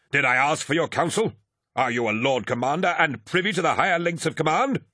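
high-pitched, scornful rhetorical questions; indignant lord commander. A narrator's reading. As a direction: put an insolent captain in his place. Eidolon rebukes his captain.

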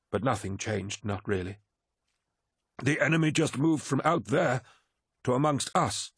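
a touch of regret, rising in pitch as it goes; lightly voiced weary captain. Captain admitting he let it happen, lightly acted regret.

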